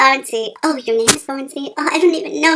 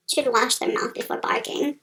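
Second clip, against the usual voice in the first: whispering; 7 dB quieter than usual. Acted. Unvoiced whisper.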